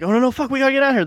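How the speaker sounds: high, loud, very fast panic; panicked kids. Retold or imitated. Kids fleeing after their dad is shot, frantic high yelling. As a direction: rattle it off in a terrified rush.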